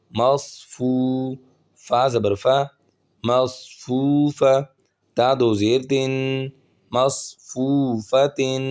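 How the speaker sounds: slow, measured syllable-by-syllable drill; spelling-drill recitation. Shown as a demonstration. Quran teacher spelling words for learners, measured recitation. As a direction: draw out the long syllables evenly.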